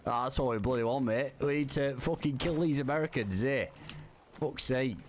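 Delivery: hammed-up British lad accent, lightly done; troll as a cheeky British bloke; fake British accent. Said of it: "I'm fucking talking like him".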